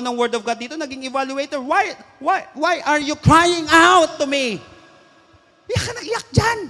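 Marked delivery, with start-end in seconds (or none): 1.6-4.6 loud, raised, insistent questioning; exasperated rebuke. Acted. Preacher voices God rebuking endless crying, loud and exasperated. 5.7-6.6 high-pitched scolding shout; mock scolding. Preacher scolds the one who keeps crying, high exasperated shout.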